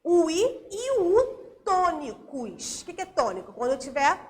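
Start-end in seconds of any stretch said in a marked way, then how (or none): 0.0-2.8 "o I e o U tônicos" drawn out slowly; stressed I and U. Shown as a demonstration. Teacher giving pupils the accent rule, slow and emphatic. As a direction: stretch "I", "U" and "tônicos", each set apart. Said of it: "a gente vai acentuar sempre".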